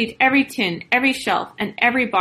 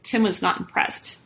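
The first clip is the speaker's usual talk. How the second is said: ordinary delivery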